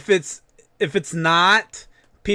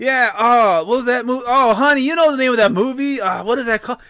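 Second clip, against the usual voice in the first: mocking loud moviegoer; 6 semitones higher, 4 dB louder than usual. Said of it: "talking about the movie, you know, loudly".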